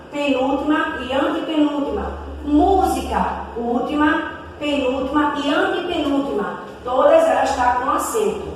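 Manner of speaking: usual speaking voice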